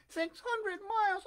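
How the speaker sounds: very high, slow puppet echo; astonished Pinocchio. A narrator's reading. Puppet echoing the pigeon's distance, high slow amazement. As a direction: repeat it high and drawn out in wonder.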